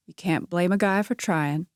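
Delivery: ordinary delivery, nothing marked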